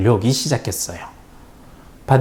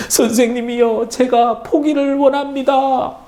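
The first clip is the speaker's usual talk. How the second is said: crying plea; 12 semitones higher than usual. A narrator's reading. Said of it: "펑펑 울면서 이렇게 이야기했답니다".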